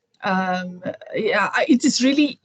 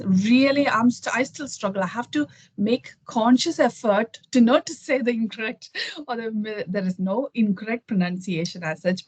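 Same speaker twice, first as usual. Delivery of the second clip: normal talking voice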